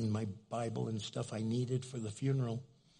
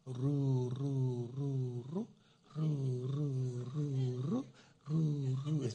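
imitated soothing lullaby trill, rising at phrase ends; Cindy; 5 dB quieter, much slower than usual. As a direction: croon a rolling "roo" to put a child to sleep. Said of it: "Cindy does this thing".

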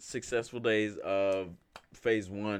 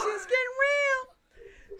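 very high, slow, fake sobbing; mock crying; 4 dB louder, much slower than usual. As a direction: wail it like fake tears. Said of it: "My homie's getting emotional".

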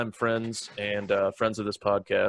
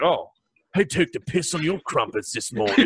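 a cheeky English bloke teasing; mock English accent; 5 semitones higher than usual. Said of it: "That's what they say in England".

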